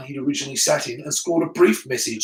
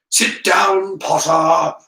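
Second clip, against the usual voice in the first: loud, raised angry order; angry teacher's command, pushed up and loud; 3 semitones higher, 7 dB louder, much slower than usual. A narrator's reading. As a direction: order the pupil down angrily. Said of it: "said an angry voice".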